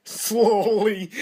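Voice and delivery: high, loud word through laughter; laughing. Real, not acted. Child reacting to a slowed-down clip, high laughing shout.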